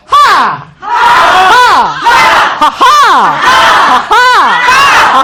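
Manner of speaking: loud, explosive "ha" laughs; big laugh contest. Shown as a demonstration. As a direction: belt out forced belly laughs. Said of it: "웃음소리 대결 한번 해볼까요".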